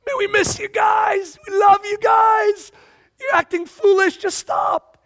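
high, drawn-out affectionate shout. Acted. Friends sending hearty greetings to the church, shouted affection. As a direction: holler it long to faraway friends.

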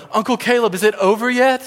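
put-on a frightened four-year-old asking mid-ride; scared nephew. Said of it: "look up at me and go".

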